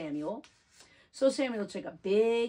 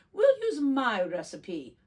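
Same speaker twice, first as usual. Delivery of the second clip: slower, louder, insisting on her way; firm; 5 semitones higher, 7 dB louder than usual. Acted. Grandma Yetta to Grandma Sophie, firm and insistent. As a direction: plant her feet on her recipe. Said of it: "Grandma Yetta said firmly".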